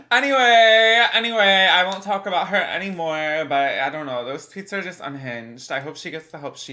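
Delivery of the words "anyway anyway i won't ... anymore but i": loud. Real, not acted. Loud change of subject.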